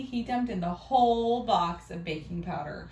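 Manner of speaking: plain reading voice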